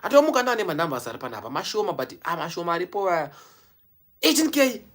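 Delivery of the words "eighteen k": excited cheer. Real, not acted. Vlogger cheering his subscriber milestone, loud and high. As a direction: shout the number out in joy. Celebrating subscriber count live.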